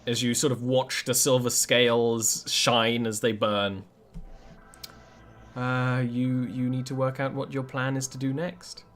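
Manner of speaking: usual speaking voice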